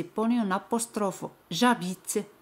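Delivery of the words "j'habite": "J'habite" run together with no E after the J; French "J'habite", J gliding straight into the A; elided vowel. Shown as a demonstration. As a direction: say "J'habite" as one word, silent H. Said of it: "se elimina la vocal".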